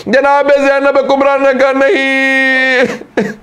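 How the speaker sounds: high, strained wail of a grieving preacher, slowing; wailing preaching. Acted. Preacher lamenting Zainab's farewell to the mourners, wailing. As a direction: cry out the tragedy at full force, drawing out the words. Climax of the majlis recitation.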